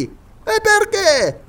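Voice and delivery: high excited scholar calling down from a tree; Paganel's voice. Acted. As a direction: play the absent-minded geographer, pleased with himself.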